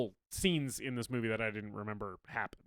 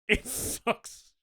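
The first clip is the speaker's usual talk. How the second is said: laughing; 7 semitones higher, much slower than usual. Real, not acted. Laughing emphatic verdict.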